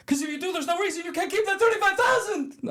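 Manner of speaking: very high, strained-up plea; hammed-up frantic prisoner; desperate film quote. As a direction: blurt it in a high put-on voice. Acting out Andy's desperate plea.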